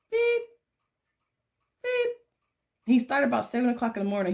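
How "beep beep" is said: high, short imitated electronic beeps; mimicking a little bird's single beep. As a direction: chirp it like a one-note bird. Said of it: "He goes".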